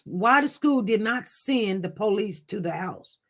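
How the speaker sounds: normal talking voice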